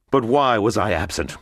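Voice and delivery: bitter cry. Acted. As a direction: throw the question back at her. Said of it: "cried Monte Cristo".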